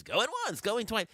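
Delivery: high, bright auctioneer call. Retold or imitated. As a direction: imitate the CBS auction announcer. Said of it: "the guy who talks and says".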